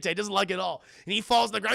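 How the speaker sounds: normal talking voice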